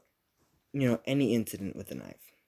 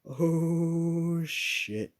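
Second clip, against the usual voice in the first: drawn-out, higher-pitched "oh"; retold dread, stretching out the exclamation; mild mock panic, savoring each word; kinda freaking out; 8 semitones higher, much slower than usual. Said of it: "I was kinda freaking out".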